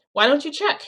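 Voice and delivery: high, loud, rising taunt; defiant, provoking question; challenging dare. Retold or imitated. Arms folded, dares him in story.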